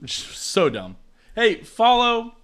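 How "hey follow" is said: mock announcer. Shown as a demonstration. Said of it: "outro announcer plug".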